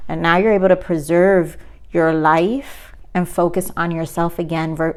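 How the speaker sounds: ordinary delivery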